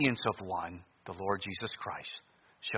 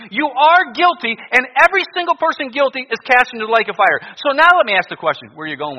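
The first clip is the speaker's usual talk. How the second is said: high, loud fire-and-brimstone preaching; doomsday street preacher; 10 semitones higher, 13 dB louder than usual. Acted. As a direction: thunder out the warning of judgment.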